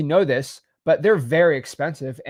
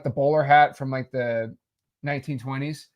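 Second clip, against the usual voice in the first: ordinary delivery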